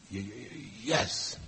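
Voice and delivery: stuck y- sounds before a soft "yes"; Vincent's stutter. Retold or imitated. Vincent strains to answer Dyer, imitated stammer on "yes". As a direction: fight to push out "yes" through a stammer. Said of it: "finally he said".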